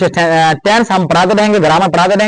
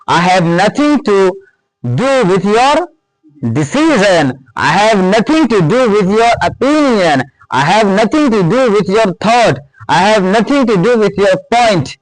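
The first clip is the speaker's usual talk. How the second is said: slow, deliberate model sentences; slow dictation; much slower than usual. Shown as a demonstration. Teacher reading examples to students, slowly. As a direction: lay each word down for the class.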